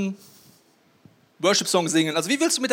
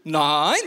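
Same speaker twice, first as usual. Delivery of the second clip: low NEIN with a rising end; demonstrated intonation; 5 semitones lower, 3 dB louder than usual. Shown as a demonstration. Owner telling the dog no, "Nein" low and rising. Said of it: "Das heißt, du sagst".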